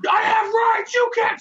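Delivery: high mock-outraged shout; indignant. Imitating a drunk protesting as his backpack is grabbed, indignant. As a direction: holler it like a drunk being manhandled.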